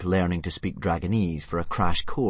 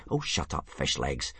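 soft, low-key impatient hush; mildly impatient, softly spoken; 6 semitones lower, 5 dB quieter than usual. A narrator's reading. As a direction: shush your friend wearily. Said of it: "said Hiccup impatiently".

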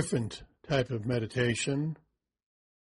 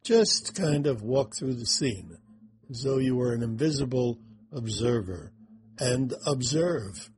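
guided meditation. Acted. Guiding through the scene.

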